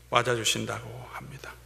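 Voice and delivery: hushed, breathy whisper after the build. Real, not acted. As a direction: let it fall to a whisper. Preacher drops to a whisper. (속삭이며 조용히)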